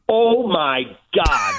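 drawn-out, high "oh my God" of awe; mock awe. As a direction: stretch it out high, as if overawed. Imagined fan reaction to a team.

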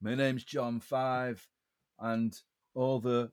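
very slow, hushed character voice. Acted. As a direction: draw it out softly in character. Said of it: "So if Jon Snow was [name]".

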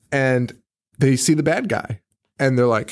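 normal talking voice

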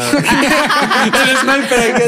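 laughing while teasing; giggling through the words. Real, not acted.

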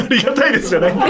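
fast, high, laughing comeback; host teasingly scolds the guest, laughing; laughing retort. Real, not acted. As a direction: fire it back through a laugh.

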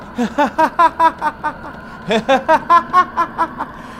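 loud, high echoing cackle; eerie ghost laugh. Retold or imitated. The ghost chasing them down the stairs, loud high eerie laugh. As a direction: laugh like it's right behind them. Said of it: "the laughter is right behind us".

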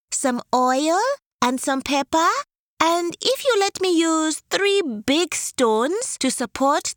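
high, coaxing tortoise voice; sly tortoise. Acted. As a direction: tempt them sweetly. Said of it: "said the tortoise".

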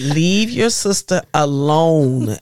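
slow, deliberate mom warning; stern slowed-down mother. Retold or imitated. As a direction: drag each word out like a final warning. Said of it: "I said".

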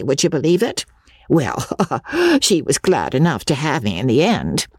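a lightly voiced rustic old trouper, chatty and a bit sly; folksy old showman. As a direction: ramble on like a talkative small-town old-timer. Mellhop greets Magnolia, folksy.

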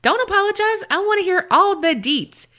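high, eager "Don't apologize"; bright, excited reassurance; eager inner voice. Retold or imitated. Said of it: "I always think".

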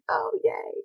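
hammed-up high "yay"; mock delighted squeal. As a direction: squeak a little cheer of delight. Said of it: "And I'm like".

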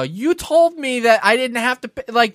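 retold aggrieved comeback; indignant protest. Arguing he was tricked.

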